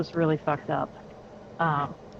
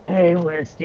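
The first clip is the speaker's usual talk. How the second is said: croaking with a wrecked cold voice; lost voice; 4 dB louder than usual. Retold or imitated. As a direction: call out hoarsely with a broken voice. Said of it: "it was".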